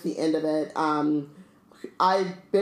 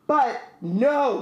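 mild growled objection, pitched up; growling; 6 semitones higher, 3 dB louder than usual. A narrator's reading. Hagrid objecting to Dumbledore stepping aside, mild growl. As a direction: read a character's growled protest with a lift in pitch. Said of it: "growled Hagrid".

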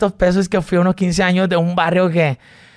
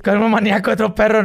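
higher joking angry retort; mock quarrel; 7 semitones higher, faster than usual. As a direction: play a comic squabble, pitched up.

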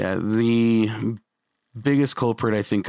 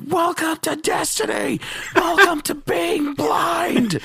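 very high, strained mock announcer cry; Destiny; slower than usual. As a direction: blare it like an assaulting title screen.

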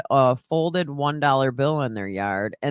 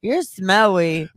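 lightly voiced toddler, slow and higher; three-year-old's voice; 4 semitones higher, 4 dB louder, slower than usual. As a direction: draw it out like a tattling little kid. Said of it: "Out of a three-year-old voice".

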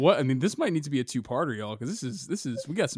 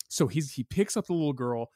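ordinary talk, nothing marked

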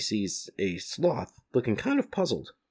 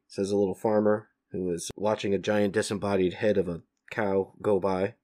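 usual speaking voice

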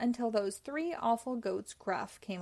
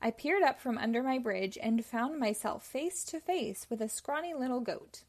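ordinary delivery

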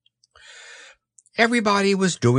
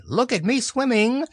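put-on high, proud child's squeal; bubbling proudly; 11 semitones higher than usual. A narrator's reading. Roo still in the water, bubbling proudly. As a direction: boast in a little high voice. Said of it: "still bubbling proudly".

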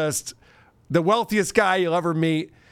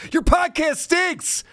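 mock host; 12 semitones higher than usual. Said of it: "the host is like".

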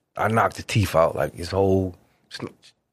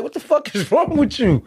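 exasperated. Retold or imitated. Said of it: "I'm like".

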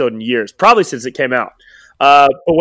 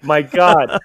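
ordinary delivery

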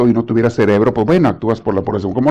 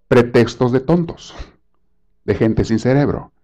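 ordinary talk

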